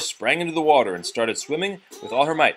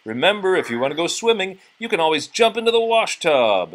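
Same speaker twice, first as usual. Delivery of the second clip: higher, louder, fast jeering; mocking taunt; 6 semitones higher, 5 dB louder than usual. A narrator's reading. Nephews mocking Minderella about the wash tub, loud jeer. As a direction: rattle off the jibe to needle her.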